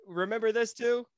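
sarcastic. Retold or imitated. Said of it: "It's like".

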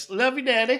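put-on high little-kid voice; sweet kids. Kids saying goodbye to their dad, sweet high voice. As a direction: pipe it up like an adoring child. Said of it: "so they're like".

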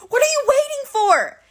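high, loud demand; fierce impatient shout; impatient yell. Acted. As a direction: push him to strike. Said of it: "Clarisse yelled".

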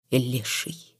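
hushed, hurt, unvoiced hiss; offended hiss. A narrator's reading. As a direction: spit the insults under her breath. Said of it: "обиженно прошипела она".

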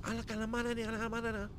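high, babbled fake Spanish; mock Spanish. Mimicking a lover's Spanish, high mock babble. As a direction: imitate a woman in squealed Spanish gibberish. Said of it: "'cause she was going".

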